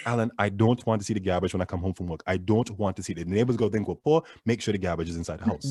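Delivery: quick Trinidadian mom scolding; attempted Trinidadian accent. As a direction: try a Trinidadian accent on a stern order. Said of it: "But I'll try it anyways".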